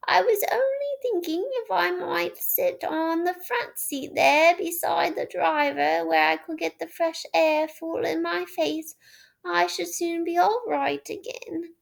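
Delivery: high, put-on old woman's voice; sly washerwoman. A narrator's reading. Toad disguised as washerwoman angling for the front seat. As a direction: wheedle politely for the front seat.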